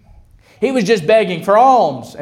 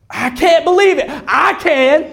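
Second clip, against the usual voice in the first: high, amazed exclamation; pitched-up joyful amazement; excited disbelief; 8 semitones higher than usual. Acted. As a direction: cry it out in acted astonishment. Acted reaction to good news.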